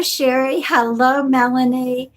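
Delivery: ordinary talk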